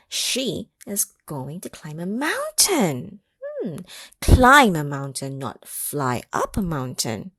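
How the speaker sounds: ordinary delivery, nothing marked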